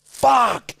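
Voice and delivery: a mild mock yell of fuck you; half-hearted shouting in the car; retold road-rage yell. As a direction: blurt it like a cranky driver. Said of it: "And I'm just screaming".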